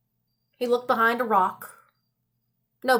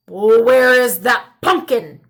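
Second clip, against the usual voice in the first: put-on croaking old man; 8 dB louder than usual. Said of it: "croaked the old man".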